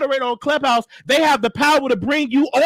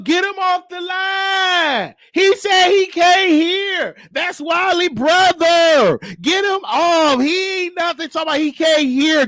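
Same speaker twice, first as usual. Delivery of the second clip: drawn-out, high heckling cries; high-pitched mock hollering; heckling callers; 5 semitones higher, slower than usual. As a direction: mimic callers yelling out in long, slow whines. Acted callers heckling to remove him.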